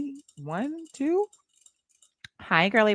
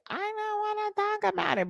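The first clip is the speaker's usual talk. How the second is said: mocking voter; 13 semitones higher than usual. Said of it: "they're like".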